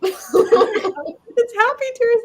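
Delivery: high, slow, weepy and wobbling; teary happy sob. Retold or imitated. Telling her kids why she cries, tearful and slow. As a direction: squeeze it out through happy tears. Retelling crying when husband returned.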